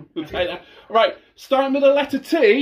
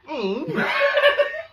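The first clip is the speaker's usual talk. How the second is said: shrieking laugh. Real, not acted. Shock at phone question.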